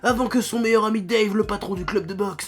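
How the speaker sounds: deep dramatic trailer narration; movie trailer voice. Acted. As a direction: epic movie trailer read. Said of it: "une voix de bande-annonce de merde".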